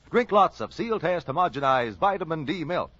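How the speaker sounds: normal talking voice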